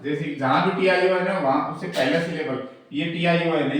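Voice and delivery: ordinary delivery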